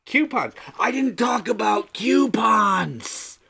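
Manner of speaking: high, slow, stretched shout; comic high-pitched yell; hammed-up outburst. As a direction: wail it in mock dismay. Mock outburst over forgotten list item.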